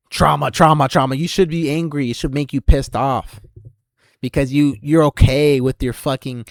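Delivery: loud, jeering rant; taunting yell. Acted.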